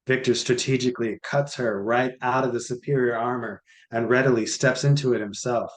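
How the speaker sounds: ordinary delivery, nothing marked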